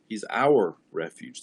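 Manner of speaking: "our" leaned on, slow and firm; stress on our. Shown as a demonstration. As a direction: press "our" in "He's our refuge". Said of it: "He's ours collectively".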